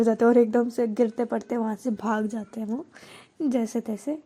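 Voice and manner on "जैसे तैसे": usual speaking voice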